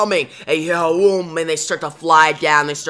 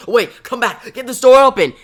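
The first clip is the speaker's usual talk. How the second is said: high, loud shout after someone leaving; urgent raised-voice demand; panicked calling out; 10 semitones higher, 9 dB louder than usual. Acted. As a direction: yell to be let out. Crane trapped, calling after janitor.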